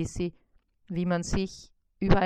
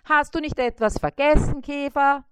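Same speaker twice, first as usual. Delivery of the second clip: higher, louder prompting question; pointed fly question; 7 semitones higher, 6 dB louder than usual. A narrator's reading. Fly reminding the beetle of the food, high pointed. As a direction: nudge him pointedly. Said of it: "fragte Bissi verwundert".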